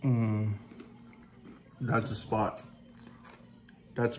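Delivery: talking with food in mouth; mumbling around a mouthful; chewing while talking. Real, not acted. Seen 3: bites dip-covered chip at -3, jaw working, lips pressed throughout.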